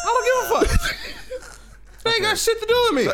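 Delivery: high, laughing shout; laughing outburst. Real, not acted. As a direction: blurt it out cracking up.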